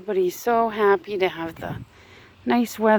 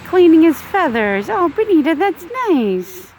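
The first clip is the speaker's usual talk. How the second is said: cooing pet talk; 7 semitones higher, 5 dB louder than usual. Real, not acted. Cooing over her chickens.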